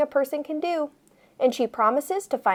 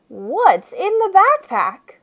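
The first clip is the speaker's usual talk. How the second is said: put-on curious kid voice; 6 semitones higher, slower than usual. Narrator voices a friend's question.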